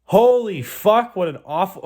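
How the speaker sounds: high, loud, drawn-out holy fuck; embarrassed wail; real exasperated outburst. Real, not acted. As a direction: cry out in cringing disbelief. Cringing at the awful interview.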